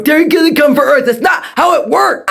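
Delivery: high-pitched exasperated yell; exasperated outburst. Acted. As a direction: burst out in frustration. Exasperated teacher phone rant.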